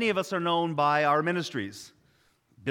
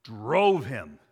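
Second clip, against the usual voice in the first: "Drove" hit louder and lower; "Drove" louder, low and falling; stress on Drove; 4 semitones lower, 6 dB louder than usual. Shown as a demonstration. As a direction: punch "Drove him" hard. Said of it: "Didn't nudge him".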